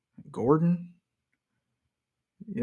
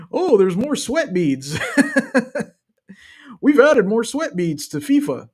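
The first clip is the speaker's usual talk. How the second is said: mock-amazed, higher pitch, breaking into laughter; amused sarcastic announcement, pitched up; mocking, laughing hype; 7 semitones higher, 3 dB louder than usual. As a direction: laugh as you mock the big new feature. Mocks game makers' selling point, laughing.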